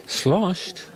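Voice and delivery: ordinary talk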